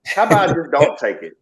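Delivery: a defiant, sarcastic suggestion. Real, not acted. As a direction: toss it off with mock innocence. Sarcastic reply about taking the vaccine.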